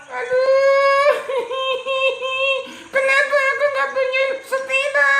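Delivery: high, hammed-up sobbing complaint; mock wail. Walker wails he has no bicycle, hammed-up. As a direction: wail over the missing bicycle. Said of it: "Yang jalan kaki dia sedih".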